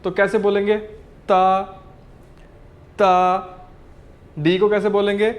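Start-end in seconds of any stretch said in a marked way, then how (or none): 1.3-3.1 ता with an unaspirated t; pinyin d as t. Shown as a demonstration. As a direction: say ता without a puff of air. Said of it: "डी को हम बोलेंगे जैसे हम बोलते हैं ते".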